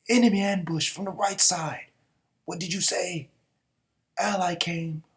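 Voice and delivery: high, loud panicked report; alarmed battle shouts. Acted. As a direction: cry out the ambush in alarm. Soldiers report an ambush.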